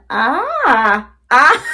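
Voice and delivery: high, loud burst of laughter; real laughing fit. Real, not acted. Signing off to viewers, bursts out laughing.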